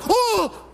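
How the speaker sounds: high pained oh. Retold or imitated. Kid hit in teeth.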